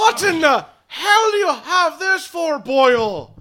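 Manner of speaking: high, loud outraged wail; appalled druid. Acted. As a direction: cry out in horrified disbelief. Game master voices appalled druid.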